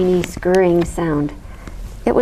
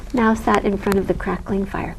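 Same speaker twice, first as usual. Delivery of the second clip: plain reading voice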